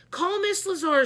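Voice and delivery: high loud urgent shout. A narrator's reading. The teacher calling for the custodian, a loud urgent shout. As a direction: call for help. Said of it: "shouted Miss Daisy".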